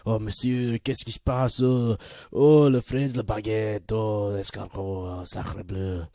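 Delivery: hammed-up fake French accent, loud; mock the French with a cartoon French accent. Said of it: "everyone will be like".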